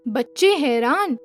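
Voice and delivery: plain reading voice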